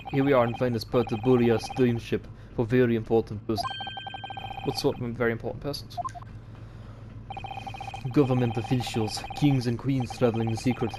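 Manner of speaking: put-on proud Russian sailor accent; boastful Russian accent. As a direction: show off the first class like a Russian guard. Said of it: "I like to do Russian accents".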